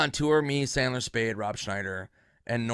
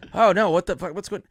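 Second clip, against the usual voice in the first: louder, higher alarm; mock alarm; 9 semitones higher, 9 dB louder than usual. Spade reacting to the gun scare, loud mock alarm. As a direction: blurt in shock.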